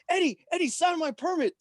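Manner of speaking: high, eager plea imitating the cousin; excited begging. Cousin begging Eddie to sign his driving permit, high and eager. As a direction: pitch it way up like a starstruck kid. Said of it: "He's like".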